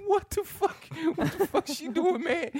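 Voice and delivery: very high squealed disbelief; hammed-up high-pitched bafflement; mock alarm. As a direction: act out comic alarm at the top of your range. Retold shock during massage.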